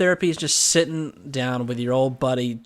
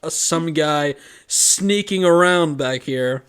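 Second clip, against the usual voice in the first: loud, raised official-report voice; mock firefighter report; 3 semitones higher, 6 dB louder than usual. Firefighter telling the dad about a masked man, hammed-up report. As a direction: play a firefighter announcing the findings, starting loud and high.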